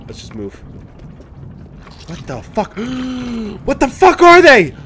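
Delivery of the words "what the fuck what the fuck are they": startled gasp then shout; shocked yell. Real, not acted. Creatures appear, yells.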